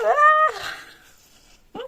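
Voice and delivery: high, joyful little "Ah!"; delighted cry. Real, not acted. A knitter delighted by her husband's gift, high joyful cry. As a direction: squeal it out with joy.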